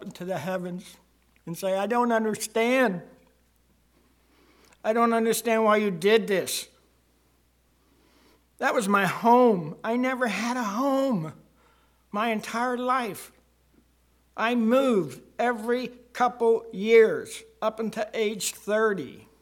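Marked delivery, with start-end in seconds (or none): 1.8-3.0 loud, pained plea to heaven; anguished cry. Retold or imitated. Father praying alone in the yard at night, loud and anguished. 4.8-19.3 loud, raised, pained lament; anguished prayer. Retold or imitated. Man in his yard at night asking God why, loudly anguished.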